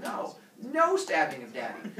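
loud, firm dad forbidding it; stern scolding. Acted. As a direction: lay down the law, mock-stern. Father scolds the children.

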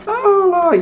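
giddy "oh, Lord"; delighted exclamation. Real, not acted. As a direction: cry out in joy at the food. Laughing over the food.

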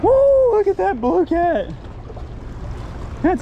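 high, loud, slow triumphant whoop; whoop of joy. Real, not acted. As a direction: cheer the fish out of the water. Landing a big catfish.

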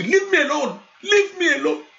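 put-on sulky child pushing God away; repeated petulant protest; exasperated brush-off. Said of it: "Sometimes I will say".

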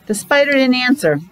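plain reading voice

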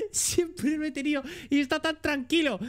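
laughing through the line; giggling as he reads it out. Real, not acted. Streamer laughing at an odd game character.

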